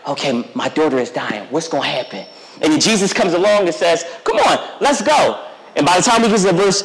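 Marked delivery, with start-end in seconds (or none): 0.1-2.3 low, soft and anxious worried dad; nervous father. Retold or imitated. Jairus fears for his dying daughter, low and anxious. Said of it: "he's probably nervous". 4.2-5.4 eager call. Retold or imitated. Jesus answers Jairus' plea.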